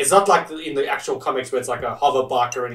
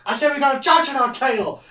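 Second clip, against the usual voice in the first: much higher, louder, yelled repeat; repeated shout; 13 semitones higher, 4 dB louder than usual. Retold or imitated. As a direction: shout it again over the noise. Shouting over the chase.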